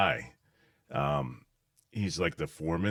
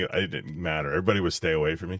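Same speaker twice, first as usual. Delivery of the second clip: ordinary talk, nothing marked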